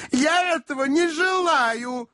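very high, slow feminine voice; whining spinster aunt. A narrator's reading. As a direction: moan it plaintively up high. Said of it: "пробормотала незамужняя тётушка". (капризная тётушка, высоко и протяжно)

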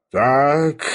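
slightly heavy, drawn-out reply; heavy, weighty. A narrator's reading. Filipp Filippovich takes in the news, heavy. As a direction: weigh the single word down a little. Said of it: "тяжело молвил Филипп Филиппович".

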